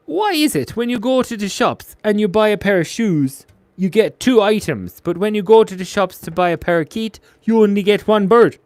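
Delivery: high, projected comic's voice; hammed-up joke setup; put-on joke-teller voice. Acted comedian's joke delivery.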